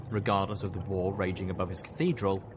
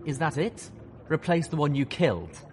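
high, raised questioning voice; challenging colonel; 7 semitones higher, 3 dB louder than usual. A narrator's reading. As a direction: press the villain for an answer. Colonel challenges villain in narrated dialogue.

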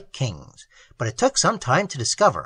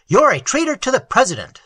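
loud, higher angry accusation; 7 semitones higher, 6 dB louder than usual. A narrator's reading. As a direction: spit out the charge of treason. Sentry accusing Enriquez.

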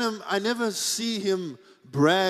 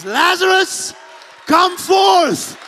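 high, loud command; commanding shout; 9 semitones higher, 9 dB louder, much slower than usual. Acted. Jesus calling Lazarus from the grave, a commanding shout. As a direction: call the dead man out of the tomb.

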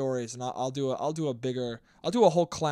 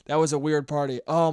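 normal talking voice

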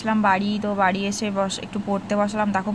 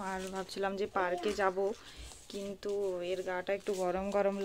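speaking softly; 2 semitones lower, 11 dB quieter than usual. Real, not acted. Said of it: "ওই জন্য একটু আস্তে আস্তে কথা বলছি". (ঘুমন্ত বাচ্চার পাশে খুব আস্তে কথা বলা)

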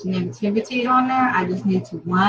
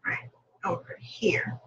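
whispered aside; 4 semitones lower, 9 dB quieter, slower than usual. Real, not acted. Pointing at daughter photo.

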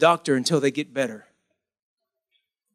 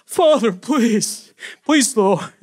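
higher, slow pleading to the father; mock pleading son; 6 semitones higher, 4 dB louder, slower than usual. Prodigal son rehearsing his plea to father, mock pleading. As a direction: beg for forgiveness, milking every please. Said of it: "he started rehearsing".

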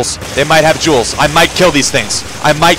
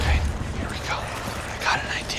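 hushed whisper, much softer; 16 dB quieter than usual. Real, not acted. Gamer sneaking past monsters, whispering to partner. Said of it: "Why am I whispering?".

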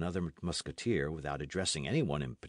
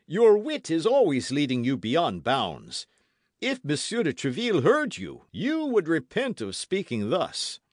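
raised, emphatic scolding musketeer; reproving Porthos; 10 semitones higher, 8 dB louder than usual. A narrator's reading. Porthos warns Aramis about his wit, reproving. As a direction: rebuke him in a high, forceful voice.

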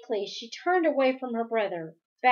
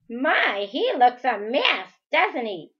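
sniggering; 3 semitones higher, 7 dB louder than usual. Acted. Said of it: "said and sniggered".

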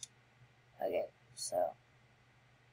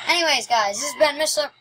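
energetic outro; 6 semitones higher, 7 dB louder than usual. Real, not acted. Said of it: "outro sign-off start".

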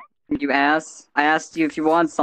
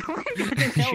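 laughing; 10 semitones higher than usual. Real, not acted. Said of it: "You literally sound like a robot".

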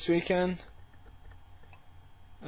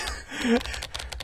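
breathy laugh; 12 semitones higher than usual. Acted. Laugh after her guess.

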